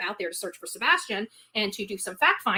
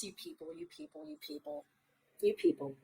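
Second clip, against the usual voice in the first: much softer, half under the breath, repeating in disbelief; exasperated you people; 4 semitones higher, 14 dB quieter than usual. Real, not acted. Fed-up host to her viewers, muttered under the breath.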